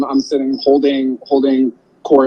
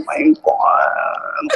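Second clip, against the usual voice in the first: high, slow breathless gasp; breathless mock amazement; much slower than usual. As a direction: gasp it like a diva.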